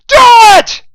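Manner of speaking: very high, loud violent command; savage scream. A narrator's reading. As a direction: scream it with the gun pressed. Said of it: "and screamed".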